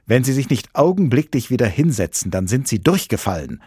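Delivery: irate examiner, moderately loud; yelled threat. A narrator's reading. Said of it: "schrie der prüfende Professor".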